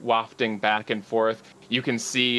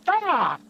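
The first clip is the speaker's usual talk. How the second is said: high falling-character yell; short high cry of alarm on impact; acted scream; 3 dB louder than usual. Lily crashes into a tree, acted high scream. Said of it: "you hear Lily go".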